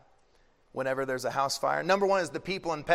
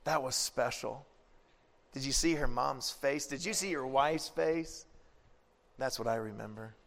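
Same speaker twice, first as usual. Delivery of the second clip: soft, lower, fond remembering; tender Jesus; 3 semitones lower, 7 dB quieter than usual. Retold or imitated. Jesus answering Peter softly, quiet and tender. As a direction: recall it gently, quietly. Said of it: "And Jesus goes".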